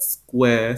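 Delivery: "square" with one long vowel, no R; British "square", drop the R; extended vowel. Shown as a demonstration. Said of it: "so extended vowel".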